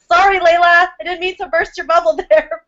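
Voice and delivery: high, loud, laughing through the words; laughing apology. Real, not acted. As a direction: apologize while still cracking up.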